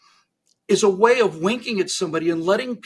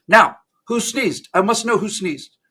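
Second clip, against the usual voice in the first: angry demand, raised in pitch; angry Stalin; 4 semitones higher, 5 dB louder, slower than usual. Acted. Angry Stalin to the remaining audience, insistent angry demand. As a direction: interrogate the room like a dictator. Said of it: "Comrade Stalin is clearly angry".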